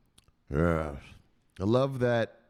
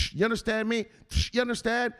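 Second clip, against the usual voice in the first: high, hectoring question; demanding enforcer; 10 semitones higher than usual. Acted. Enforcer drilling the victim, high and demanding. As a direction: press hard for an answer.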